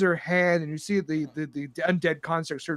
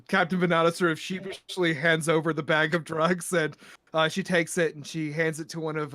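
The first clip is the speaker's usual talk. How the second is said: usual speaking voice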